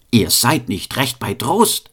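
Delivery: ordinary delivery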